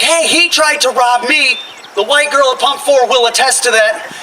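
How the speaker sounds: high, emphatic self-defence; high mock protest; protesting innocence. Protests to the cop.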